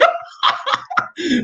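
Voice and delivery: a burst of high, breathy laughter; squeaky wheezing laugh; high airy laugh. Real, not acted. Laughs at his own joke.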